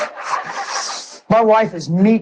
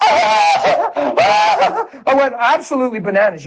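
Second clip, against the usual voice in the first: high, slow laugh-shout; overjoyed laughing; laughing joy; 8 semitones higher, slower than usual. Real, not acted. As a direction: laugh through the words. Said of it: "joyous retelling".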